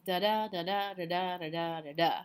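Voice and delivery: da-da chanted with every second DA stressed; singsong da-da pairs, weak then strong; iambic rhythm. Shown as a demonstration. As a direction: beat out da-DA, da-DA in an even pulse. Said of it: "by using unstressed, stressed".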